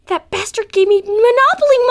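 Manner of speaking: high, loud indignant outburst; outraged discovery. Acted. Girl finds her brother gave fake money, high outraged outburst. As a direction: shriek it in shocked outrage.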